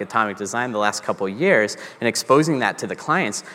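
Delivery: normal talking voice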